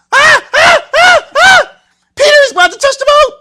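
very high, full-force shrieks; screaming bloody murder. Acted. Disciples as the figure nears the boat, panicked screaming. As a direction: scream in hammed-up terror. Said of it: "they're screaming for bloody murder".

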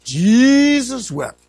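high, loud, drawn-out mock solemn verse; grandfather's short grace. Preacher imitating grandfather at dinner grace, loud and drawn-out. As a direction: stretch it out grandly, like a mock blessing. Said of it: "Papa would say".

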